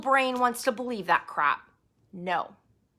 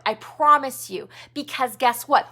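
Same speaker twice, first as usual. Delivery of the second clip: normal talking voice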